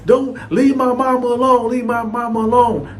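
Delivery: put-on high child's voice begging; little boy pleading. Young son begs his dad to leave mom, high child voice. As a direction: play the scared small son, pitch way up. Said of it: "with a five-year-old run out there".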